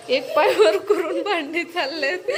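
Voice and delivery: slow, loud words through laughter; laughing commentary. Real, not acted. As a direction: laugh as you describe her. Amused at child's posture. (हसत हसत सांगणे)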